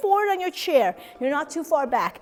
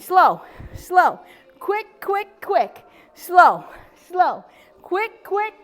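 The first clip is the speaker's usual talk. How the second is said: raised, chanted beat with short "quick"; rhythmic workout count; 4 semitones higher, much slower than usual. Shown as a demonstration. Instructor leading slow and quick leg lifts, rhythmic cadence. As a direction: keep time for the exercise, SLOW stretched, QUICK short. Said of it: "we're gonna do quick".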